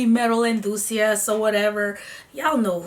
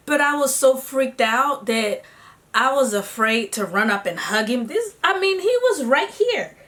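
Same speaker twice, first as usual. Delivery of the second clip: ordinary delivery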